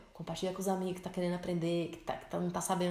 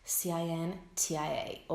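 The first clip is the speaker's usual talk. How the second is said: slow model question; 2 semitones lower, slower than usual. Shown as a demonstration. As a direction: spell out the model for students. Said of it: "você tem que perguntar".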